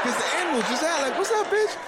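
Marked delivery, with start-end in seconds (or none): 1.2-1.8 very high, cheeky animal taunt; cocky animal voice. Retold or imitated. Wild animal greets tourists, high and cheeky. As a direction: voice the bear like a swaggering punk.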